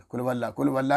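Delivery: "قل هو اللہ" clipped and hurried; rushed recitation. Shown as a demonstration. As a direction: gabble it quickly as a wrong example. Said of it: "نہیں ہوتا". (جلدی جلدی غلط انداز میں پڑھنا)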